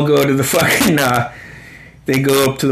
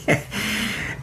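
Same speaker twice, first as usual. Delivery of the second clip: a much softer, airless chuckle; a quiet voiceless laugh under the breath; soft silent laugh; 9 dB quieter than usual. Real, not acted. After quoting Merle's comeback, soft silent laugh.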